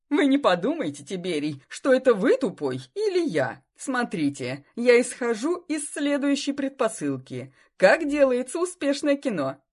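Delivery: loud, brash businessman voice; pushy sponsor. Acted. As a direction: boom out the idea eagerly. Sausage king keeps lecturing.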